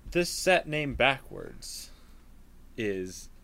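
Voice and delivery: slow, teasing reveal; slow dramatic buildup. Pretend. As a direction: draw it out for suspense.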